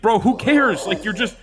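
exasperated. Real, not acted. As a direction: throw up your hands at it. Exasperated at golfer's practice.

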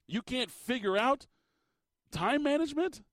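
high rising disbelief on management; incredulous. Real, not acted. Host mocking coach's failure.

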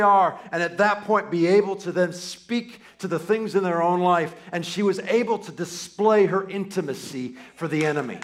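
loud, rising sermon climb, every line pushed; full-force preaching. Acted. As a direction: build the sermon to a loud, fervent peak.

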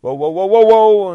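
loud, high cries of alarm; acted-out alarm. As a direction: cry out as if slipping away. Heroine fizzles out of existence.

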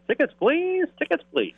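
mock conductor calling tickets; train conductor. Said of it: "the conductor come through and say".

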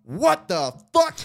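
outraged yell. Retold or imitated. Retelling his blowup at the newcomer, a slow outraged yell. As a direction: blow up in disbelief, drawn out. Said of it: "and I was like".

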